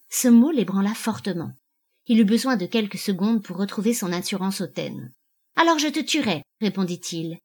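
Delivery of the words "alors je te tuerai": higher-pitched, hate-filled threat; hateful threat. A narrator's reading. Husband threatening his wife over divorce, hateful. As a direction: answer at once with hatred, falling at the end. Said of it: "avec une haine qui lui déforma".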